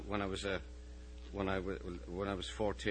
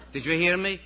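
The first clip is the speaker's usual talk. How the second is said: stern supervisor; 10 semitones higher, 13 dB louder than usual. Acted. Supervisor challenges the boy.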